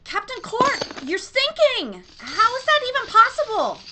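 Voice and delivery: high, loud exclamation of alarm; alarmed cry. Acted. Space Carry to her sinking captain, in loud alarm. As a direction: cry out in shocked disbelief.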